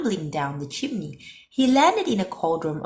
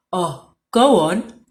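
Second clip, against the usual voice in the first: a louder, sniffly wolf plea; sniffy wolf; 8 dB louder, slower than usual. A narrator's reading. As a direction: sniff through a stuffy nose. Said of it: "sniffed Big Bad".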